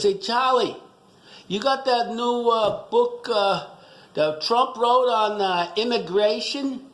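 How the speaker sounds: hammed-up Archie Bunker ask. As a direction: lean in as a blunt old guy asking for a book. Archie asks bookstore owner.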